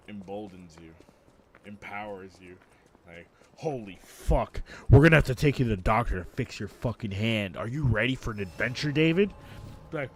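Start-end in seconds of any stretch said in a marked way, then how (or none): 3.6-9.3 loud, over-the-top urgency; hammed-up alarm. Adult emboldens the faking kid, hammed-up alarm. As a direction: boom it out like launching a mock adventure.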